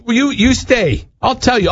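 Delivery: mock indignant diner. Diner to intruding waiter, mock indignant. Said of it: "It's like".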